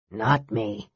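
low, soft, tired mage; weary. A narrator's reading. Mage to his friends as he sinks down, weary. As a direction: let it sag, low and soft. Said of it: "sinking down wearily".

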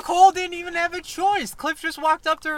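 fired up defending Nicole; heated outrage. Real, not acted. Fan ranting about Cliff's move, loud and indignant.

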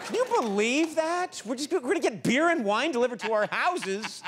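high-pitched, hammed-up excitement; mock amazement. Hammed-up amazement at beer delivery.

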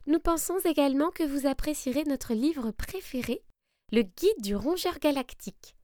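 high, gentle rabbits reading their letter; kindly little rabbit writing; high sweet rabbit voice. Acted. Rabbits' letter read aloud.

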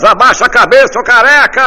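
very high, loud yell; heckler's shout. Acted. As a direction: yell it from the back row. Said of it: "gritou um filho da puta lá atrás".

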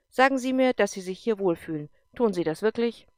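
loud, commanding officer voice; a tough general pressing a colonel hard; stern general. Acted. General challenges Erik, loud and commanding.

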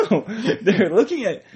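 loud laughing protest; laughing loudly. Real, not acted. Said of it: "You don't need to talk that loud".

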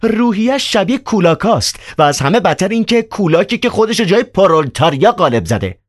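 very high, mocking and hostile; aggressive rant. Ivan attacking Riukhin before the doctor, very high and scornful. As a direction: lash out at Riukhin, pitched very high. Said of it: "هوس کرد به ریوخین حمله کند".

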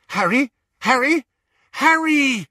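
high, loud frantic calls of a name; calling out. Acted. Hermione searching the house calls out for Harry, high and loud. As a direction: call him urgently from far off.

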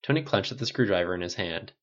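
ordinary delivery

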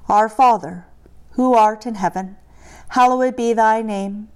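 normal talking voice

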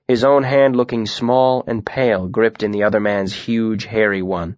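ordinary delivery

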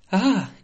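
sudden loud high yelp; startled scream. Acted. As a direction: cry out in shock. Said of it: "gritó el cocinero".

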